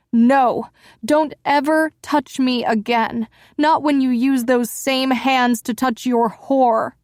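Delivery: loud, high furious outburst; wife shouting at her cheating husband, loud and furious; yelling at him. Acted. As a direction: throw the accusation at him at full voice.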